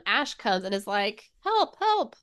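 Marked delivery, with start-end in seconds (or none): 1.4-2.2 much higher little squeaky call; mock cry for help. Reader voicing Ash calling out, much higher and squeaky. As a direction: squeak it like a tiny panicked helper.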